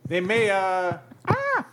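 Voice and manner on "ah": high startled yelp; sudden yelp. Real, not acted. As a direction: cry out in surprise. Sudden cry mid sentence.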